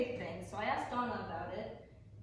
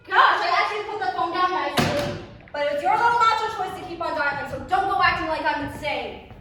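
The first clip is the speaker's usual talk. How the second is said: screamed, far louder and higher; furious screaming; 5 semitones higher, 14 dB louder than usual. Acted. Georgie screams at Andrew for phoning her boss, furious. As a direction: let her rage boil over at him.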